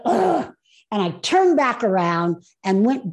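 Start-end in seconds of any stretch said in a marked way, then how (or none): 0.1-0.5 high, reluctant "ugh"; exasperated higher-pitched groan. Retold or imitated. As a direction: groan as if giving in unwillingly. Her groan at being challenged.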